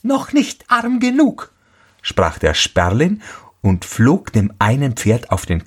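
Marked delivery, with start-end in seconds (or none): 0.0-1.5 very high bird voice; taunting sparrow. A narrator's reading. (hohe, höhnende Spatzenstimme)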